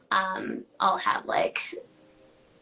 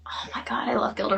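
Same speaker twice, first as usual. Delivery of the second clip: whisper; 2 semitones higher, 4 dB quieter, faster than usual. Real, not acted. Gushing about favorite comedian.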